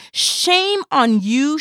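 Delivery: slow, loud, high scolding. Acted. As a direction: drag out the shame like a public rebuke. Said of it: "she said".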